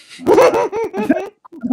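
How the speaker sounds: a burst of high, loud laughter; big real laugh. Real, not acted. Recalling the postman's mix-up.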